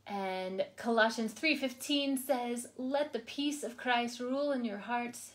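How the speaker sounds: soft, measured devotional read. Acted. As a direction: read it like a quiet prayer to the listener. Reads a daily devotional aloud.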